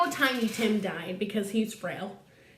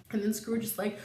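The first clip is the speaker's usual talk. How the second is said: normal talking voice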